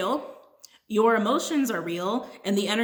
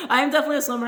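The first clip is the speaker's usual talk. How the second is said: laughing; 6 semitones higher, 6 dB louder, much faster than usual. Real, not acted. Laughing after own joke.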